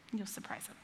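breathy hushed aside, almost no voice; whispered aside. Real, not acted. Pastor whispers a hint to the congregation, hushed. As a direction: lean in and whisper it like a secret.

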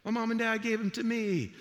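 put-on high, bright kid voice telling a fib; childish fib. Young boy lying to his friends about the matches. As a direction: play the innocent little liar. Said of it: "I'm like".

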